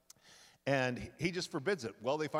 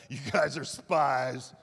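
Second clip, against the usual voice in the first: Joseph barks the charge, slow and louder; slow, loud accusing Joseph; mock accusation; 8 dB louder, slower than usual. Joseph accusing his brothers of spying, slow and loud.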